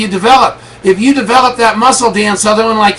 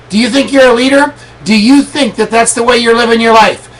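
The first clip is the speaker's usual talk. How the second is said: ordinary talk, nothing marked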